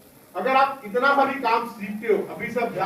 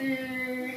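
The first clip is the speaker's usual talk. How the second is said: drawn-out amplifier squeal, imitated by mouth; mic feedback squeal; 3 semitones higher, 6 dB quieter than usual. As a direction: imitate a microphone's feedback whistle. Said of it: "जब पहली बार mic operate करो".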